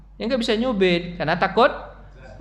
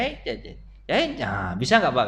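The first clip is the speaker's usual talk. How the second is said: usual speaking voice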